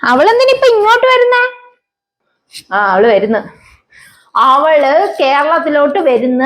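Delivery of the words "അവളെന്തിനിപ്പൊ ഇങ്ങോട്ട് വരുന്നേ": much higher, startled and anxious; alarmed question. Acted. Mother hears the foreign daughter-in-law is coming, alarmed. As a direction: jump up in alarm at the news.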